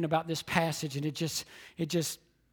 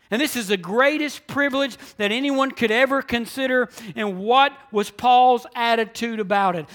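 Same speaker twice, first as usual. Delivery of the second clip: loud, high, driving sermon delivery; full-force preaching; 7 semitones higher, 12 dB louder than usual. Real, not acted. Preacher urging the church to go and tell, full-force preaching. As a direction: preach it out with punched key words.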